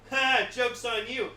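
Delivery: loud gloating villain. Retold or imitated. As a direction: crow it loud and triumphant.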